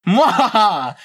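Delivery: hammed-up villain cackle; evil laugh. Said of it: "the big old mean bad guy".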